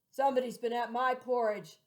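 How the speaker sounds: big booming papa bear voice; loud, gruff father bear; great gruff bear. Acted. Papa bear to his family, loud and gruff. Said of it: "in a great, gruff voice".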